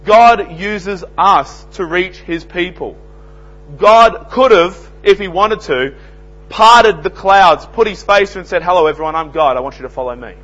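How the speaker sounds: loud, high preaching, slowing on key words; fiery sermon delivery; full-force preaching. Acted. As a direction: thunder it out from the pulpit. Preacher building to loud climax.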